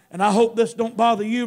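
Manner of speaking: normal talking voice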